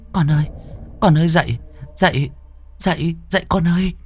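put-on high, urgent calls to wake the baby; frantic calling. Mother shaking her limp baby, frantic high calls. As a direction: call the child desperately.

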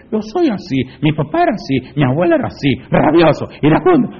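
high-pitched mock excuse, jumping up on each phrase; mimicked excuse. Preacher mimics people excusing their temper, mock-defensive.

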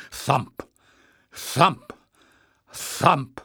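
loud, pounding beats like a great heart; booming thumps. A narrator's reading. Narrator booming the throbbing sound for the listener. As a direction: strike each thump like a drumbeat.

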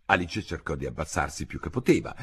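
ordinary delivery

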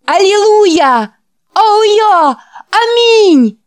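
high, loud Hallelujah shout; ecstatic shout. Acted. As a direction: cry it out in rapture. Boys' ecstatic shouting response. (восторженный крик)

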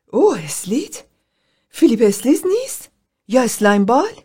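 high, sharp mocking questions; shocked disbelief. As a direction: recoil in disgust at the name. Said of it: "پم سراسیمه". (ناباوری شوکه و تحقیرآمیز با صدای زیر)

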